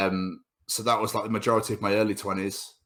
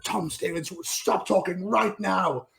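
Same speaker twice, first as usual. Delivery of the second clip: high, slow scolding teacher voice; mock strict teacher; 10 semitones higher, slower than usual. Teacher telling Tom to stop talking, slow high mock scold. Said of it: "They'd be like".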